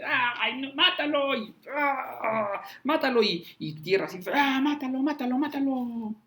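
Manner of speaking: high-pitched mob cries; frenzied crowd. Retold or imitated. Crowd throwing dust and crying for Paul's death, high frantic yells. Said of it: "imagínate así de".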